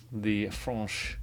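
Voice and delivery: the French said FRAHNSH in a light mock French accent; a hint of a French accent on French; fake French accent. Shown as a demonstration. Teacher playfully repeats the French in a French accent. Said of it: "my fake French accent".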